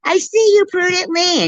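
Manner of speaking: high, loud teasing call; high sing-song call. Retold or imitated. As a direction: call out across the aisle. Mimicking woman calling out.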